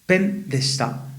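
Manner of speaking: drawn-out model of "pen deshita"; slow, careful teacher model. Shown as a demonstration. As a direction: pronounce "pen deshita" slowly so learners catch it. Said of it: "entonces puedo decir".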